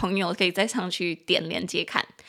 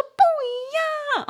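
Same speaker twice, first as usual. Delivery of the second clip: much higher, slow firm denial; insistent colleague; much slower than usual. Retold or imitated. Colleague insisting the models differ, high, slow and firm. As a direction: drag out the no like an offended collector. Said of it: "我的同事说".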